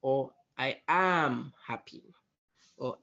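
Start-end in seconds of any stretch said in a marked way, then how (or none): 0.6-2.0 slow, with "am" stretched and emphasized; stress on am. Shown as a demonstration. Coach demonstrating tone of voice, stress on "am". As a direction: lean hard on the word "am". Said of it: "for example, I can say".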